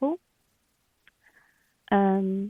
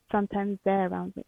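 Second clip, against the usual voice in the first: ordinary talk, nothing marked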